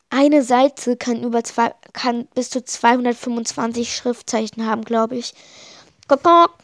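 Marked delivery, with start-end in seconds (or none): 6.1-6.5 much higher, much louder hen clucks; squawking chicken call; chicken clucking. A narrator's reading. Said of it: "macht das Huhn".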